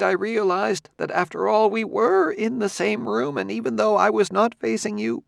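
lightly voiced squeamish scholar; disgusted scholar. A narrator's reading. Quemot disgusted.

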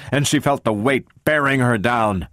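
usual speaking voice

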